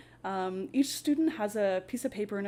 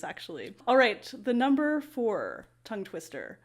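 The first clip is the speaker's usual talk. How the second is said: ordinary talk, nothing marked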